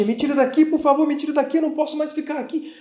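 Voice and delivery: high, loud, mock-sobbing beg; imitating crying plea. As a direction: wail to be taken away, hammed-up. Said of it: "começou a chorar". (homem chorando e implorando, choro encenado)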